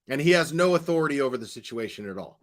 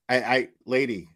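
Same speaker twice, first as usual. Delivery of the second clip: normal talking voice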